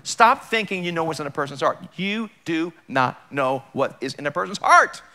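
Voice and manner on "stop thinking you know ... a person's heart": ordinary talk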